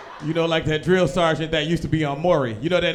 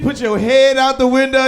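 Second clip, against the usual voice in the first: normal talking voice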